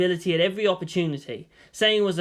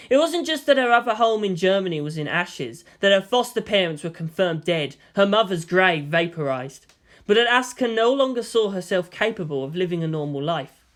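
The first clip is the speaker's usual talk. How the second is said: usual speaking voice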